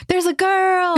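high-pitched excited exclamation. Retold or imitated. Gamer spotting another girl in game, excited. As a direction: blurt it out, delighted and high.

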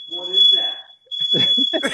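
startled, rising high question; suddenly alarmed at a noise; surprised. Real, not acted.

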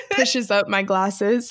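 usual speaking voice

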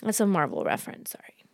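sheepish whisper, almost no voice; whispered aside. Real, not acted. Explaining a nerdy reference to co-hosts, whispered.

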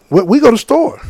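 high mock kid voice; kid's answer. Kids answering the quiz, high mock kid voice. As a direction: answer like a naive city kid. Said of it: "the simple answer".

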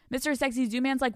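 normal talking voice

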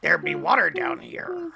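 high pirate speech. Pretend. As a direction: talk like a pirate. Pirate talk.

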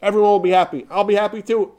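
high, eager retold promise; excited. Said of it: "he excitedly said".

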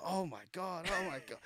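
soft, high "oh my God" of disbelief; amazed onlookers. Retold or imitated. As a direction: imitate friends freaking out softly. Imitating the friends' amazed reaction.

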